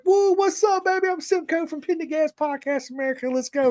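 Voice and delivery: very high and wildly excited, hamming it up; over-the-top loud greeting. Retold or imitated. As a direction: greet the driver like a hype man. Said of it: "'cause I'm loud and my accent".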